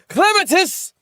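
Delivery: high shouted call of a name, imitating the anime. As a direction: cry out the name like the show does. Calling out character name.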